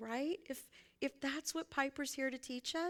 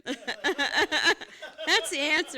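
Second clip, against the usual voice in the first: laughing it out, slow and much louder; 5 semitones higher, 10 dB louder, much slower than usual. Real, not acted. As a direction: let the laugh carry the line. Laughs at her own point.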